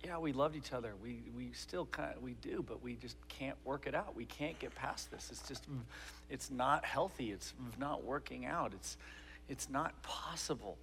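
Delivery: put-on soft, halting explanation to a child; hushed, regretful father; gently explaining dad. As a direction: explain painful news quietly, with false starts. Dad explaining split to daughter.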